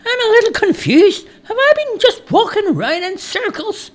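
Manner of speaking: very high-pitched lost traveller; confused stranger. A narrator's reading. Stranger asking if he walked in circles, confused character voice.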